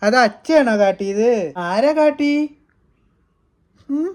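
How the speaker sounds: high, loud mock-concerned questions. Real, not acted. Father soothing crying baby. (കൊഞ്ചിച്ച്, ഉയർന്ന സ്വരത്തിൽ ചോദിക്കുന്നു)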